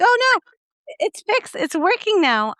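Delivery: high, dismissive mock dentist voice; brushing it off. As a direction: wave off the patient's worry, lightly hammed. Dentist insisting, retold.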